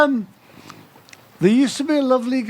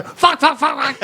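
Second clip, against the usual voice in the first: shouted swearing; 7 semitones higher than usual. Retold or imitated. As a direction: blurt out the swears. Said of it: "I just like to go".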